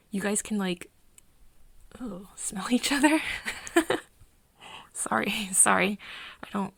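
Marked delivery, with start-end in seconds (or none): 2.3-6.0 laughing through an apology, louder; stifle a laugh while apologizing; amused laughing. Acted. Friend reacting to vampires smelling each other, amused laughing. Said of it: "I don't mean to laugh".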